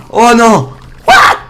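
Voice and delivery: high, loud startled cry; panicked yell. Real, not acted. Player discovers spiders everywhere, shouting in panic. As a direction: yelp in alarm at a sudden swarm. Surpris par une foule d'araignées.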